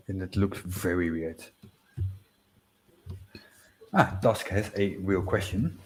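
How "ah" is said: normal talking voice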